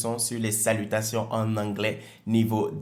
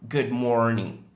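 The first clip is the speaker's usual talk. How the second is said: Good morning with morning stressed; slower than usual. Shown as a demonstration. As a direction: push the word morning. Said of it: "l'accentuation est sur morning". (Good morning, accent sur morning)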